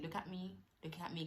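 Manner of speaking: a quiet reassuring murmur; soft soothing. Retold or imitated. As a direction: gently coax her to keep eye contact. Doctor soothing her in surgery.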